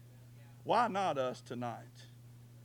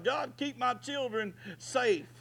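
shouted prayer; 8 semitones higher, slower than usual. Retold or imitated. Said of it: "we need to be shouting to God".

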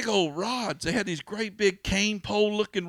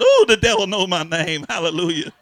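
bold loud reply; 10 dB louder than usual. Retold or imitated. Exorcist's bold answer retold.

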